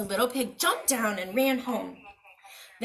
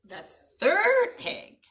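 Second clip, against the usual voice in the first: plain reading voice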